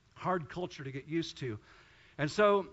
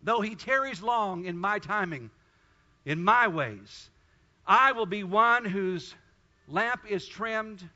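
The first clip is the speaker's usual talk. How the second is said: fervent prayer; 3 semitones higher, 6 dB louder than usual. Acted. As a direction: cry out to God for the whole room.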